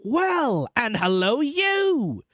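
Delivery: hoarse call swooping high; hoarse reply. A narrator's reading. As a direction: hail back hoarsely as Jerry. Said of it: "said Jerry, more hoarsely than before".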